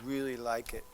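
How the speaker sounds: low, soft, halting fake enthusiasm; unconvincing praise. Shown as a demonstration. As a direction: praise it with no conviction, pausing between phrases. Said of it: "I'm in trouble, right?".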